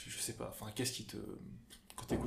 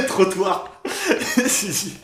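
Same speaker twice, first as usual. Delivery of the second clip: laughing; 10 semitones higher, 16 dB louder, much slower than usual. Real, not acted. Laughs at guessed lyric.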